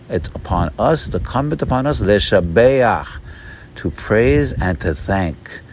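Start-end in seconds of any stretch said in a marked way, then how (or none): 2.0-3.2 Leshabeach drawn out at half speed; slow demonstration. Shown as a demonstration. Teacher breaking down the prayer, Leshabeach said slowly. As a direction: stretch Leshabeach slowly through each syllable. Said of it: "Let's say it slow".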